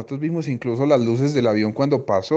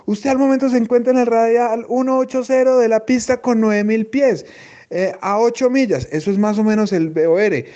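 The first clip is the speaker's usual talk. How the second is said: higher, careful imitated controller voice; controller giving position; 9 semitones higher, 3 dB louder than usual. Controller telling the pilot his position, measured higher voice.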